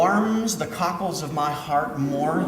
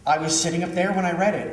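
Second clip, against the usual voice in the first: usual speaking voice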